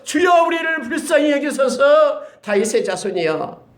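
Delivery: high, loud, slow desperate shout. Acted. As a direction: shout it out over the crowd. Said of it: "더욱 소리질러서".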